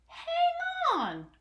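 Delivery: very high squawky duck voice; a duck cutting in fast; quacking duck. Acted. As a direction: squeak it out like a duck. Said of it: "quacked the duck".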